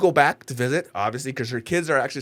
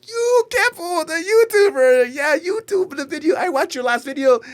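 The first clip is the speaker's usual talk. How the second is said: high, loud gushing put-on voice; excited Filipino auntie; 7 dB louder than usual. Friend's family greeting the YouTuber, high gushing voice. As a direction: squeal it like a thrilled auntie meeting a YouTuber. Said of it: "their family, like".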